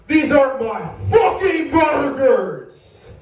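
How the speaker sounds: drawn-out, high-pitched furious yell; angry customer yelling. Acted. Customer yells from the back of the restaurant, outraged. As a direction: holler it slowly across the room in outrage. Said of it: "is yelling at me".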